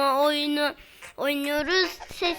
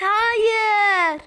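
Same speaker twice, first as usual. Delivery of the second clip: loud, falling anguished cry; 5 semitones higher, 8 dB louder than usual. Real, not acted. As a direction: shout it in dismay. Caught near end of game.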